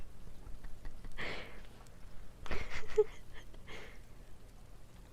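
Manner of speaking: high giggles trailing away; helpless laugh that slowly dies down; real giggling fit. Real, not acted. Still giggling over the word.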